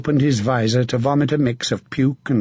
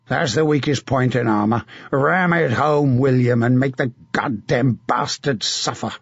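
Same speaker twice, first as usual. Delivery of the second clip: a battle-hardened knight urging a comrade on; hard, rough commander's voice; grim veteran knight giving orders; gruff knight. Acted. Sir John advises William.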